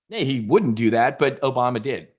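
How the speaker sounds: normal talking voice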